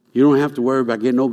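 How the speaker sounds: rushed, fast run-through of the same line; fast repeat. Shown as a demonstration. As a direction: rattle it off fast the second time.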